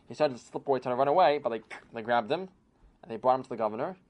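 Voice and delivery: usual speaking voice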